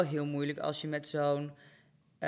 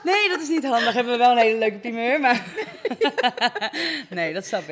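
hoog en luid door het lachen heen; laughing; 7 semitones higher, 11 dB louder than usual. Real, not acted. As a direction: giechel door de woorden heen, hoger en luider. Lacht om het antwoord. (hoog en luid lachend)

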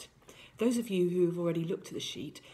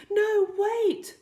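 high, loud desperate cry; queen's cry; 9 dB louder than usual. A narrator's reading. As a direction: cry out to stop them, very high. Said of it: "queen cried".

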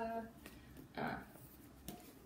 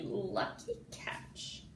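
hushed aside; 7 dB quieter, much slower than usual. Acted. Awed aside.

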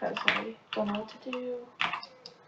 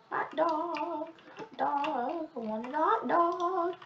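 customer voice; 6 semitones higher, slower than usual. Pretend.

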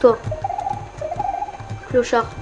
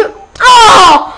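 a sudden loud laughing yelp of shock; laughing shout; 8 semitones higher, 9 dB louder than usual. Real, not acted. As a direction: burst out with a shocked laughing cry. Game over surprise shout.

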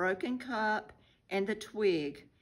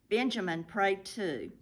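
usual speaking voice

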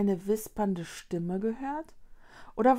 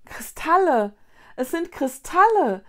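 high, loud cry of wonder; exclaim, pitched up, overwhelmed by the discovery; 7 semitones higher, 8 dB louder than usual. A narrator's reading. Konrad discovers crystals on the walls, overwhelmed. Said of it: "rief Konrad überwältigt".

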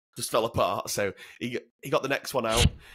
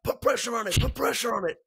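much higher, repeated urgent order; urgent medic; 11 semitones higher than usual. Retold or imitated. American medic ordering injured marine to press vein. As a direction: bark it like a medic mid-flight. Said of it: "He's like".

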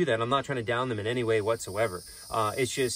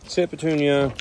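high, loud command; calling a command; 5 semitones higher, 8 dB louder than usual. Real, not acted. Keeper telling Daisy the pig to sit, loud command. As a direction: call it out high to an animal.

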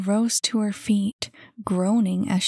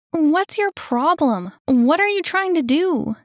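put-on high, loud indignant girl; indignant outburst; 9 semitones higher, 6 dB louder than usual. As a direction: demand an explanation, voice pushed high. Girl angrily confronts the kid.

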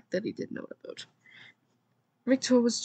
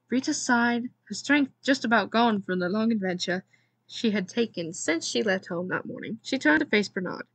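ordinary delivery, nothing marked

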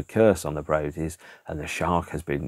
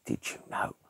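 much softer, secretive gossip voice; hushed tones; 9 dB quieter than usual. Retold or imitated. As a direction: lean in and murmur it like a secret. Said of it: "talking about it in hushed tones".

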